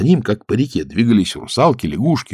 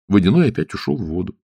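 plain reading voice

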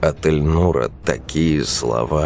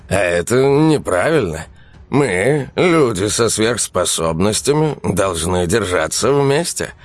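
higher, self-important lecturing; lecturing guest; 6 semitones higher than usual. Acted. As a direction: preach like a know-it-all. Эльнур поучает Александра. (поучающий гость)

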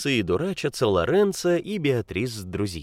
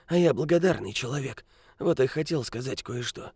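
put-on high, croaking old beggar; grumpy old man; 6 semitones higher than usual. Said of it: "закряхтел старик".

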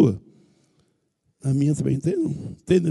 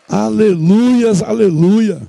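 drawn-out hallelujah; 3 semitones higher, 4 dB louder, much slower than usual. Real, not acted. Drawn-out praise.

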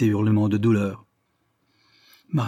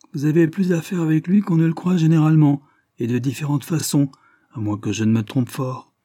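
raised, insinuating retort easing off; pointed, meaningful; 6 semitones higher, 4 dB louder than usual. A narrator's reading. Lidney answers Tiffle's denial, meaningful tone. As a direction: start high and pointed, settle to usual. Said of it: "dit monsieur Lidney à demi-voix et d'un ton significatif".

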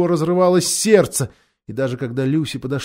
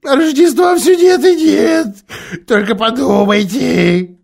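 indignant Faun; 7 semitones higher, 5 dB louder than usual. A narrator's reading. Faun indignant about the Witch.

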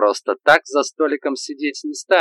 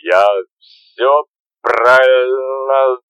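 put-on drunk babbling; 5 dB louder, much slower than usual. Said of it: "довольно лопотал Дуся".

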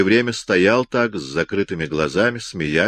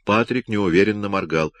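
ordinary delivery, nothing marked

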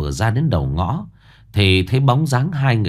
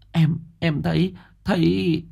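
high, halting, scared stutter; frightened stammer; 9 semitones higher than usual. Acted. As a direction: stammer it out in terror. Bà Tình terrified after seeing ghost.

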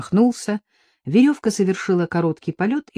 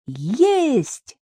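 high, stretched distant call; jubilant, soaring shout without extra volume; triumphant cry; 7 semitones higher than usual. A narrator's reading. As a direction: cry out in triumph on a long swooping note. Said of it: "закричала она торжествующе".

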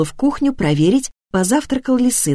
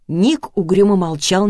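usual speaking voice

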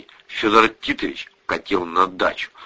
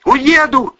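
high, loud defiant cry; shouting; 7 dB louder than usual. A narrator's reading. Daughter threatens mother with leaving, shouting. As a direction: shout it with pitch way up. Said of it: "закричала Лиля".

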